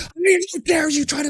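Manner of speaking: high shouted mock outrage; hammed-up furious mom, high and loud; mock outraged mom. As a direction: shout like an offended mother. Angry mom voiced again.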